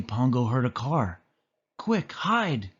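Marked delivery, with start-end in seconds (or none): 1.8-2.7 high, hurried warning; urgent whispered-feeling call to hide; urging. A narrator's reading. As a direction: push them to hide now. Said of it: "he urged".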